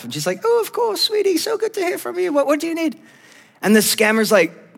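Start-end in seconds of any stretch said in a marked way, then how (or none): 0.4-3.0 very high-pitched doting English grandma impression; English grandmother. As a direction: coo like an elderly Queen of England. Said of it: "she has an accent, which is like a beautiful combination".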